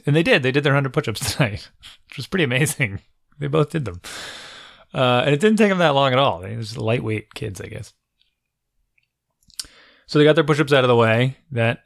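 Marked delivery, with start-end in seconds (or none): none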